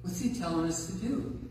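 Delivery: ordinary talk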